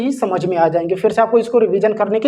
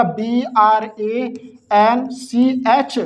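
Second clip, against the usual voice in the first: slow, deliberate letter-by-letter dictation; spaced out letters for students to copy; slow spelling; much slower than usual. Shown as a demonstration. Teacher spells word letter by letter.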